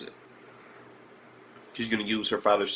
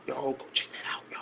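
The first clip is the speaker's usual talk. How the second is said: whisper; 7 dB quieter than usual. Real, not acted. Aside urging viewers, whispered.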